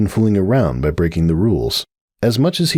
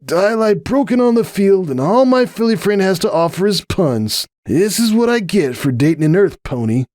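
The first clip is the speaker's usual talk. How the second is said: mock lament; 12 semitones higher than usual. Injured pegasus dramatically lamenting.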